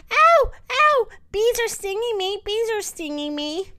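high yelps easing into a whine; yelp, then wail it in mock panic; high, hammed-up cries of pain; acted pained cry. Boy pretends bees are stinging him.

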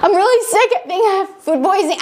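high, rushed mock crying; retold tearful whimper. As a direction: plead weakly as if in tears. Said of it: "And I'm crying".

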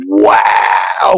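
big drawn-out wow; amazed reaction. Retold or imitated. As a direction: let out a stunned, shouted wow. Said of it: "all of a sudden the reveal fucking happens".

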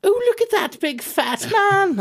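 very high, slow child impression; exaggerated sing-out in a little kid's voice; child's voice. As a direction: squeak it like a gawping small kid. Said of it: "it is usually from a child".

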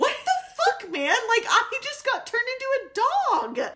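high-pitched indignant disbelief, half laughing; mock outrage. Woman just turned into a dog confronts him, mock outrage. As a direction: exclaim it in exaggerated high-voiced shock.

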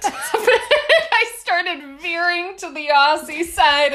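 high, loud laughing confession. Real, not acted. Laughing at own accent slip.

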